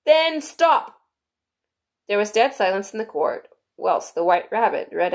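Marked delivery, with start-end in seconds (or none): 0.0-1.0 high, loud, solemn storybook king; high-voiced solemn royal order; grave king. A narrator's reading. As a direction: lay down the instruction gravely, pitched up. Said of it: "the king said very gravely".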